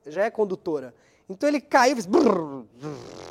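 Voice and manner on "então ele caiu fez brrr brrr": buzzing phone noise. Retold or imitated. Phone dies in sea water.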